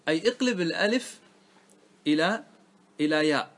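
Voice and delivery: ordinary talk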